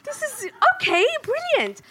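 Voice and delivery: hammed-up excitement; mock enthusiasm. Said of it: "And I remember going".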